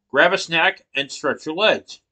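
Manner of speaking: ordinary talk, nothing marked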